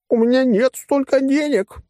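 very high, drawn-out panicked squeal; thin-voiced squeal. A narrator's reading. Threatened gang member pleading no money, squealing thinly. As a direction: squeal it in a suddenly tiny high voice. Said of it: "заверещал опасный неожиданно тонким голосом".

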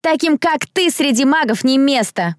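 high, haughty put-down; scornful jeer. A narrator's reading. Blonde bully to mud-covered girl, scornful jeer. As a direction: sneer it for all to hear.

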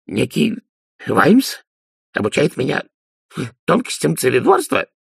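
high, halting, incredulous question; miserable babbling. A narrator's reading. Hapless Dee lectured by Vimes, flustered babble. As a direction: falter through it in rising disbelief. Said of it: "пролепетал несчастный Ди".

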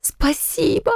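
high, delighted squeal of thanks; bursting with happy gratitude; overjoyed. A narrator's reading. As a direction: thank him with the voice leaping up. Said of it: "От счастья мне хотелось броситься".

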